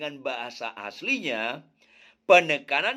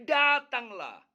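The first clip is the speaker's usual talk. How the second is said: Datanglah spoken slowly and deliberately; the emphasised Datanglah, said slow; stressed Datanglah; 4 semitones higher, much slower than usual. Shown as a demonstration. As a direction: draw out Datanglah as the key word.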